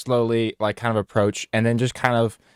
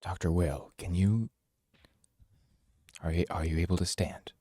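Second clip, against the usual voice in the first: soft gentle; 3 semitones lower, 9 dB quieter than usual. Acted. Gently asking survivor.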